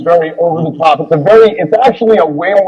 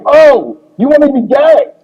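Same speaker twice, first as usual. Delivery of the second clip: sudden realization; 9 semitones higher than usual. Retold or imitated. Retold realization, much higher.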